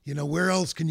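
ordinary delivery

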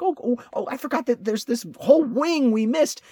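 high, retold burst of sudden remembering; excited realization. Traveler finding a missed museum wing, excited.

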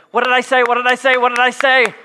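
exasperated parent, hammed-up; mock yelling. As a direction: parent at youth game yelling at kid. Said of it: "you just gotta start yelling".

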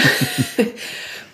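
a little airy laugh; soft breathy laugh. Real, not acted. Remembering the physio's reply, quiet laugh. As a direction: laugh it off under your breath. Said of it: "so I just sort of laughed a bit".

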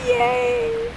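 high squealed yay; excited cheer. Real, not acted. Player cheering.

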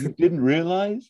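high, disbelieving mock voice; high incredulous Liz. Liz asking Sean in disbelief. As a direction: toss it back in disbelief. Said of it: "And she goes".

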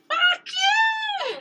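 high, harsh impersonated yell; mock furious shout from a comedy movie; angry impression shout. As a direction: bark it like a furious Hangover character. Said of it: "but angry like that".

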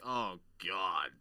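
low, soft groan of dismay; understated retold dismay; quiet dismay. Recalls own dismayed reaction to film.

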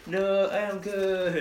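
put-on slow, stretched Israeli accent; hammy Israeli accent. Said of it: "You do an Israeli accent".

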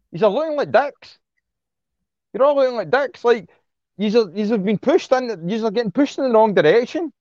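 furious at the blue tickers; angry rant. Real, not acted. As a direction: scold the influencers hard. Ranting at celebrities and influencers.

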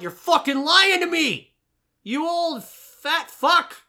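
high, loud mock yell as rude Harry. Mocking Harry yelling at Ollivander, hammed-up and loud. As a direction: play Harry snapping at an old man. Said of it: "he's out here like".

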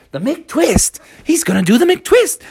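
high, thrilled crowd chatter; acted buzz of anticipation; excited onlookers. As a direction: gasp the news like an amazed bystander. Onlookers react to the stunt.

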